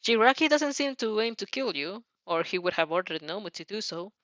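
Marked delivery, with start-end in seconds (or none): none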